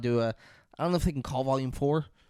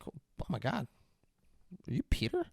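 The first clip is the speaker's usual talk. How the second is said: hushed, acted disbelief; soft voice lifting into a question; stunned recognition; 2 semitones higher, 9 dB quieter than usual. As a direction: say it quietly, as if just realizing.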